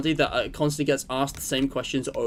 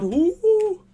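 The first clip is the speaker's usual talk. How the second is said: high, loud "woo"; excited whoop; 5 dB louder than usual. Real, not acted. Streamer celebrating with viewers, high excited whoop. Cheering during his stream.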